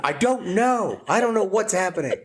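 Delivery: high, upset mock voice; imitating wife. Husband imitating his crying wife, high mock upset. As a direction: whine it like she did. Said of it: "I'm like".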